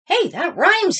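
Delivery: high, loud delighted discovery; excited cry. A narrator's reading. Clark noticing the rhyme, high and thrilled. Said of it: "he cried".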